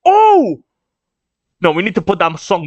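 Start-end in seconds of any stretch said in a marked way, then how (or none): 0.1-0.6 high, loud "oh"; shocked outcry. Real, not acted. Reader sees Stansen hurt, high shocked cry. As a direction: yelp in shock at what you see. Reacting to kick and injury.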